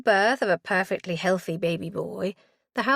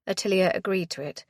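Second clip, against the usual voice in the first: usual speaking voice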